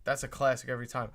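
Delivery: ordinary talk, nothing marked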